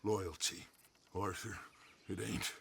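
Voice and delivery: ordinary delivery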